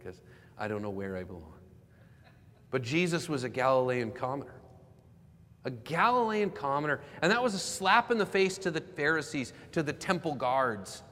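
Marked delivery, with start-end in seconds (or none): 2.7-11.0 forceful preacher's repetition; emphatic preaching. Real, not acted. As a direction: build in pitch on the repeated phrase. Preacher returns to main point.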